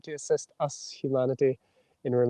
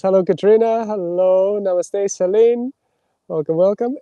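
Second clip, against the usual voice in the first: cheery greetings to viewers; 6 semitones higher, 8 dB louder than usual. Real, not acted.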